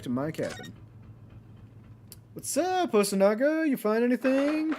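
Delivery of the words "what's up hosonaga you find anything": high, bright greeting; cheerful inspector voice. Acted. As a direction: call out like an eager young cop.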